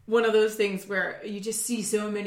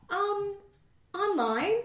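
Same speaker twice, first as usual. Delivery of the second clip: high, sheepish little admission; 11 semitones higher, 4 dB quieter, slower than usual. Retold or imitated. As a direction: admit it with mock embarrassment. Embarrassed answer retold.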